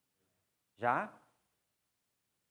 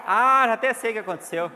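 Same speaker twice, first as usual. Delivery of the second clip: knowing laugh, much louder; 7 semitones higher, 14 dB louder than usual. Real, not acted. Teacher laughing at a student's failed attempt, amused. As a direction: laugh it out, already guessing the ending.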